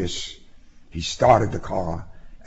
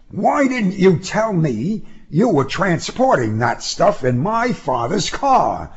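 higher-pitched, coldly put question; 10 semitones higher than usual. A narrator's reading. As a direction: ask it coldly, pitch raised. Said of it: "he asked coldly".

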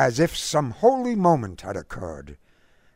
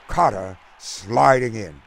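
usual speaking voice

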